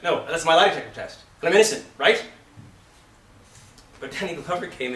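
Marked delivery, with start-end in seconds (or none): 0.0-2.2 louder, higher rehearsed comeback; insistent mock reply. Coach imitating an actor stuck in his line reading, loud and insistent. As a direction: push the memorized line out forcefully. Acting the rigidly memorized reply.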